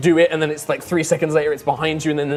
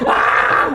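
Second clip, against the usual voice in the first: whispered scream; 5 dB louder than usual. Acted.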